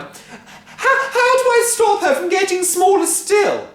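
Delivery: high, loud pleading cry; desperate cry. A narrator's reading. Wife crying out, holding tiny Grandma. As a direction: cry out in despair. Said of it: "she cried".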